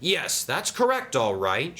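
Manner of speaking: high, eager confirmation; mild shout. A narrator's reading. Sam confirms to Diesel, eager mild shout. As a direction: pitch it up eagerly, only lightly shouted. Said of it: "shouted Sam".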